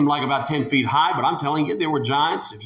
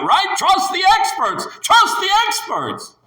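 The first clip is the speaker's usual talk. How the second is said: high, loud sarcastic jab; mock the crowd's blind trust; preacher sarcastically echoing the crowd, high and loud; mocking sarcasm; 4 dB louder than usual. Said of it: "mocking the crowd's logic".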